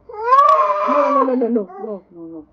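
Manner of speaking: high sobbing "no" that fades as it goes on; crying protest. Real, not acted. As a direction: cry out refusing, through tears. Child protests while hair is done.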